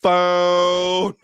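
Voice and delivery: high, loud drawn-out Southern "Phone"; mock Southern drawl on phone. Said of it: "doers always gotta be like".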